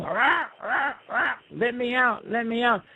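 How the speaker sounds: high strained rah rah, let me out; trapped cry. Acted. Buzzard stuck inside the buffalo, crying high to get out.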